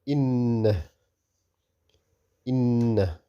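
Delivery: doubled n hummed long through the nose in "إن"; ghunna. Shown as a demonstration. Teacher models Quranic reading for learners, ghunna. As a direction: hold the n of "إن" with a nasal hum. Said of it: "we read it with ghunna".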